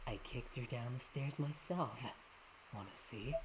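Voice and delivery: low, soft, sinister villain. Acted. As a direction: taunt them quietly. Villain voice taunting.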